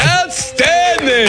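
high, drawn-out delighted shout; excited praise. Real, not acted. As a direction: cheer it, stretched and high. Praising the warm-up.

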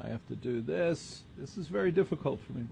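very soft, as if turned away; distracted aside. Real, not acted. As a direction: talk quietly to yourself while busy. Aside while adjusting the equipment.

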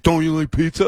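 the buddy's leading question, lightly mimicked; a hint of the friend's voice. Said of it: "that's exactly how he talked".

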